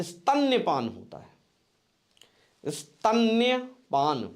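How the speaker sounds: स्तन्यपान drawn out very slowly, stan-ya-paan; slow dictation. Shown as a demonstration. Teacher stressing स्तन्यपान to students, very slowly. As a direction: spell स्तन्यपान out syllable by syllable. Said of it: "ध्यान रखिएगा".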